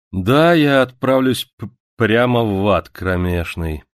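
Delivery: lazily drawn out, slowed on the end; lazy drawl. Acted. Gadfly teasing Zita, drawling lazily. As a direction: drawl it idly, unbothered. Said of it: "лениво протянул Овод".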